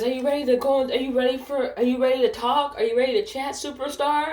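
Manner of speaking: high, singsong coaxing; imitated chirpy supervisor. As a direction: mimic a peppy boss luring someone into coaching. Imitates supervisor inviting to coaching.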